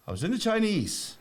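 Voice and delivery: high put-on voice of a drunk traveller; traveller's voice. Friend retelling a traveller's jail story, high mock voice. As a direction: pitch the traveller way up and answer bluntly. Voicing traveller answering questions.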